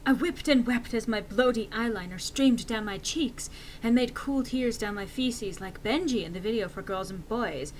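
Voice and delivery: plain reading voice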